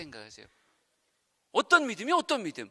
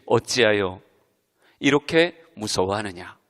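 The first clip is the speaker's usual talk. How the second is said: gentle rebuke; 12 semitones lower, 5 dB louder than usual. Retold or imitated. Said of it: "예수님이 이렇게 말씀하십니다".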